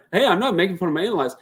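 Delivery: ordinary delivery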